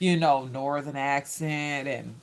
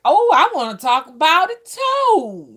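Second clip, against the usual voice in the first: high, loud burst of eagerness; sudden eager squeal; excited inner voice; 10 semitones higher, 10 dB louder than usual. Retold or imitated. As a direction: blurt it out like you can't wait. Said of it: "I'm like".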